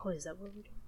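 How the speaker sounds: soft unvoiced whisper. Cohost catches on to the bit, whispering. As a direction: play along in a whisper.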